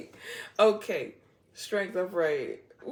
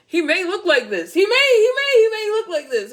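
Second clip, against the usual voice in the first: much higher and louder, gushing; excited; 12 semitones higher, 12 dB louder than usual. Real, not acted. Reader gushing to viewers about the man, excited. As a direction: gush about him excitedly.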